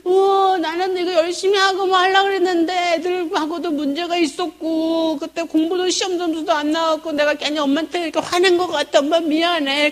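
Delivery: high, whining tearful outpour; imitated sobbing. Daughter telling mom her troubles, mock sobbing. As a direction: blubber on like a child unburdening herself. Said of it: "통곡을 하면서 크게 울어요".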